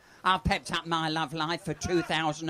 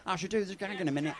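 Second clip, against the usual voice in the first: usual speaking voice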